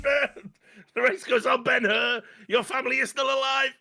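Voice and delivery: high, shouting mock Heston, dragging the words out; hammed-up Heston gloating. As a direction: ham up the dying villain's triumphant taunt. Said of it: "And then he goes".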